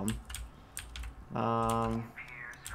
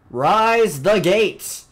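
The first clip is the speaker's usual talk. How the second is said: dramatic command; 6 semitones higher, 10 dB louder, much slower than usual. Pretend. Grand command to game gates.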